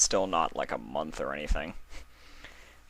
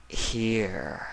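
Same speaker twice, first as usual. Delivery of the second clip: lightly voiced Nightmare Moon; 3 semitones higher than usual. Nightmare Moon answering Luna's roll call. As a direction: answer darkly from the smoke.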